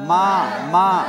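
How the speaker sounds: loud মা with vowel held one alif; drawn-out মা. Shown as a demonstration. Teacher drills one-alif length, loud drawn মা. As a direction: call out মা stretched. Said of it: "জবর এক আলিফ টেনে পড়তে হবে".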